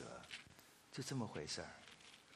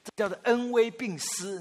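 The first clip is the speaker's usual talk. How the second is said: slow, higher, stressed phrase; preacher names the method, slow and emphatic; emphatic point; 8 semitones higher, 4 dB louder than usual. Real, not acted. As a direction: spell out the lesson slowly.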